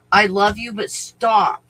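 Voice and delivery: love you but enough, said loud; exasperated command. Real, not acted. Owner to barking dogs, loud and exasperated.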